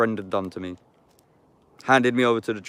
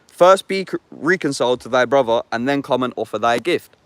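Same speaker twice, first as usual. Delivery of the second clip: raised, solemn Bible reading; scripture reading; 3 semitones higher, 6 dB louder than usual. Acted. Reading Matthew aloud in the woods, solemn and raised. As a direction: declaim the verses like a preacher.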